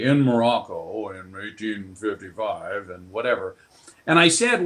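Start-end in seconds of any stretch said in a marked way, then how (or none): 0.0-3.5 put-on deep, lower sixty-five-year-old general. Shown as a demonstration. Actor redoing his old stage role as a deep-voiced general. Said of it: "I can do the voice to this day".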